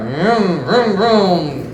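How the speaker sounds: mouthed motorcycle revving; long, swooping "vroom" noises; imitated engine revs. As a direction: rev like a motorbike, rising and falling.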